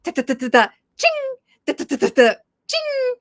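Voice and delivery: rapid "da-da-da" then a high "ching"; imitated texting noises. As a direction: mimic frantic typing and a high message chime. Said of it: "my kids are going".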